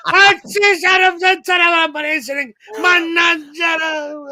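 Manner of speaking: high, loud drawn-out Italian granny shrieking; panicked Italian grandmother. Retold or imitated. Italian grandmother shrieking at her foaming grandson, hammed-up panic. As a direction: wail curses like a mock-hysterical grandmother. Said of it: "Italian grandmother".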